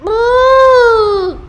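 squealing ooh, high and loud; high strained ooh. Real, not acted. High strained cry before singing.

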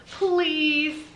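high, loud, drawn-out please; acted high-pitched begging; exasperated plea. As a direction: plead loudly, stretching the word. Exasperated plea in acted story dialogue.

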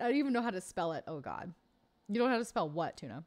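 ordinary delivery

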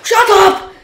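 high yelled shut up; loud snap. Real, not acted. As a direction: yell it at your friend. Annoyed at friend in ice.

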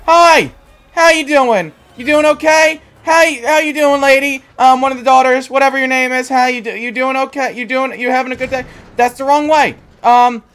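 high, loud nervous taunting; nervous mock greeting. Real, not acted. Gamer greets the vampire chasing him: high, loud nervous cheer. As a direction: greet the monster with scared cheer.

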